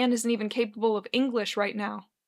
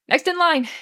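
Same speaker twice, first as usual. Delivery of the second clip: higher, loud call to the queue; 7 semitones higher, 8 dB louder than usual. Acted. Bartender calling the next customer in line, loud. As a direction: call the next one over the crowd.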